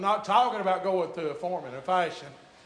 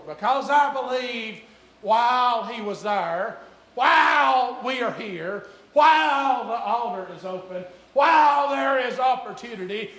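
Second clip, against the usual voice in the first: rising preaching; 4 semitones higher, 6 dB louder than usual. Acted. Preacher urging church while the altar is open, rising preaching. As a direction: build into preaching, leaning long on each "while".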